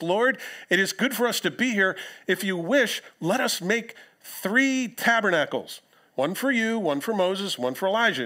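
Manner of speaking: lightly voiced eager Peter.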